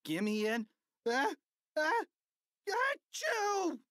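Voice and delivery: high cheer breaking into a big acted sneeze; sneezing mid-cheer. A narrator's reading. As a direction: build up to a high-pitched achoo. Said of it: "sneezed the cheerleaders".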